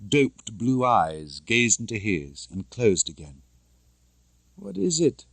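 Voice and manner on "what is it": high, groggy and cross; a drowsy irritated woman; sleepily angry. A narrator's reading. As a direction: woken girl snapping half asleep. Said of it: "The voice was sleepily angry".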